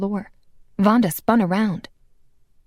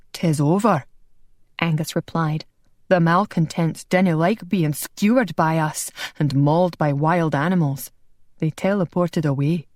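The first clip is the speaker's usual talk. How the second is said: a hint of a kindly Scot over the lad; Scottish brogue; 2 semitones lower than usual. Angus voiced throughout.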